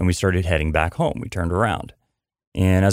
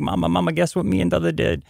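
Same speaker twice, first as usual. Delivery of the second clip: put-on much higher, bubbly little girl; daughter telling mom; 9 semitones higher, faster than usual. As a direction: tell Mama the big news like a kid. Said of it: "she's like".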